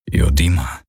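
deep, dull and hopeless; matt, hopeless. A narrator's reading. Hawks answers Kirai in a deep, hopeless rumble. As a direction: let the name fall flat and heavy. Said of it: "Es klang matt, hoffnungslos".

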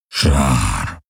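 deep alien rumble; low grumble. A narrator's reading. Hawks grumbles a warning to Kirai, deep and low. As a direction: growl the word low in the chest. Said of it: "brummte Hawks".

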